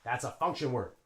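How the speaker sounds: ordinary talk, nothing marked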